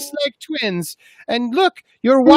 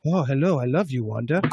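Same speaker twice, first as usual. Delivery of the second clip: lower, soft robot lover; robot Vision voice; 12 semitones lower, 6 dB quieter than usual. Acted. Vision greets Wanda in a low, soft robot voice. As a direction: coo it low like a lovesick android.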